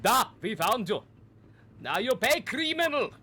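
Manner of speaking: lightly done Russian officer catching a criminal; Russian officer gloating over the caught criminal; mock Russian accent. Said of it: "Their accents were supposed to be Russian".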